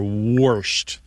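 "warshed" said as worshed; dialect worshed. Shown as a demonstration. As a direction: round the vowel before the R in "warshed". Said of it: "you do hear warsh or warshed".

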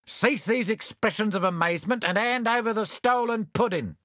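high, brisk dismissal; bell topperers. A narrator's reading. Disguised thieves brush off Bill, high and brisk. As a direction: wave away their protest. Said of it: "said the bell topperers".

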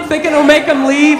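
ordinary talk, nothing marked

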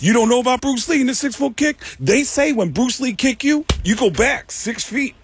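high, incredulous put-on voice; high imitation, stretching out the ending; Tracy impression. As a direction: explain it in the comedian's high voice, emphatic. Retold Tracy explaining Bruce Lee kick.

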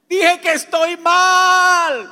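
slow, high, drawn-out yell; triumphant shout. Retold or imitated. Man declaring he is unwell like a victory, shouting. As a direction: yell it out, stretching the last word. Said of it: "yo grité y dije".

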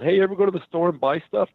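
high, quick mock comic patter; old crappy stand-up act. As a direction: parody your own hacky stand-up opener. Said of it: "my old crappy act".

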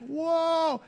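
high, drawn-out whoa of wonder; amazed whoa. Retold or imitated. Awed at canyon, high.

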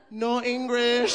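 high, slow "No English." in a mock accent; fake no English. Telling the man on the train, mock accent. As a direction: stretch "No English." in a put-on accent. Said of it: "before saying".

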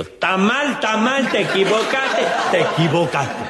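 high frantic cries of a mistake; shouting while running. Acted. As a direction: yell it while running up waving the book. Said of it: "a los gritos y flameando el libro".

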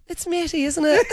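high-pitched voice of a greeter; imitated front-of-house greeting. Restaurant lady recognises a regular, high imitated greeting. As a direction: pipe up high in mock recognition.

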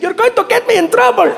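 put-on high-pitched, accented female voice; Saudi woman. Girl in audience answering comic's tease, high accented voice. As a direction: imitate a woman protesting in a high voice. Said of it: "She said".

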